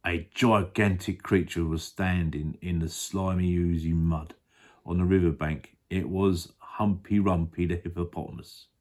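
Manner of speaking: ordinary delivery, nothing marked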